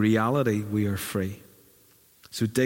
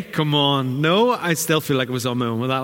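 ordinary talk

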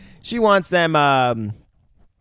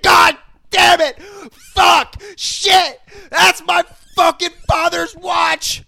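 much higher, louder, slow raging yell; furious shout; 9 dB louder, much slower than usual. Retold or imitated. Retelling the movie, imitating Bruce screaming about his father's watch. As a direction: bellow it like a man losing his mind.

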